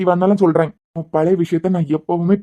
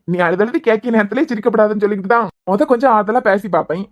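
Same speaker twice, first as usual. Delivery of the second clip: normal talking voice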